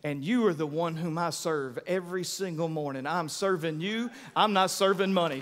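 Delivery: low, soft sincere prayer; a believer praying to Jesus; quiet prayer. Retold or imitated. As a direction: pray it softly and earnestly. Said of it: "when you say".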